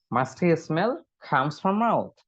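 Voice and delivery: "Musty smell comes from mouth" drawn out very slowly; slow model reading. Shown as a demonstration. Teacher repeats the sentence slowly for learners. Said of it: "উচ্চারণটা খেয়াল করবেন".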